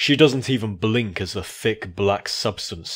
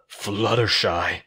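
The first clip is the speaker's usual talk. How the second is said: furious whisper; slower than usual. Acted. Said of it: "I whisper furiously".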